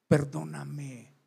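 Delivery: slow, soft, low plea for forgiveness; tender apology. Acted. Preacher models the apology people never say, tender. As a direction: draw the word out gently, humbly.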